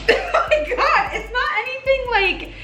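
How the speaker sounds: high, giggling "it's not anything"; giggly reassurance; laughing. Real, not acted. Same words, laughing high.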